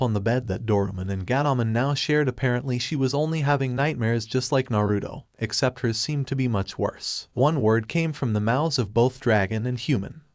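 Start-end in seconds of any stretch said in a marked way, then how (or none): none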